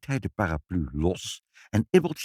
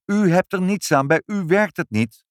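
called out; 9 semitones higher, 6 dB louder than usual. A narrator's reading. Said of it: "riep vader".

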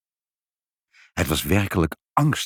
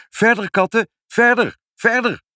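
high, loud commands; urging call; 14 semitones higher, 5 dB louder than usual. A narrator's reading. Mother urging the cats to keep digging, high loud call. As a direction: spur them on with ringing high calls.

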